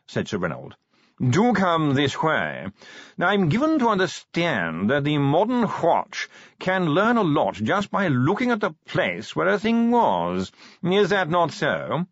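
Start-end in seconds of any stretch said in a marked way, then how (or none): 1.2-12.1 high, slow drawling toff; posh yawning voice. Acted. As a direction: yawn the words out like a toff. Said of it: "modulated yawning".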